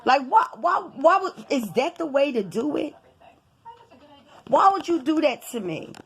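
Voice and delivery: high, insistent retold reproach; exasperated, wounded demand to a partner; aggrieved protest. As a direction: stammer the question in indignation. Acted quarrel with partner.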